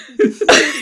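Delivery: squealing loud laugh; high laugh. Real, not acted.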